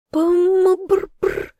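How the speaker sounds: high gurgling call, half drowned; choking on water. A narrator's reading. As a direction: try to call out through water. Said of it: "пыталась позвать Яна, но вновь захлебнулась".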